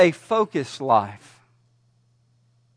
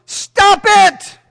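put-on high, loud shout at the kids; parent yelling; 12 semitones higher, 7 dB louder than usual. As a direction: snap it at running children. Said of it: "run around with your children yelling".

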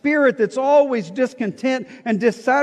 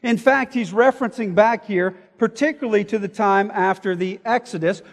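normal talking voice

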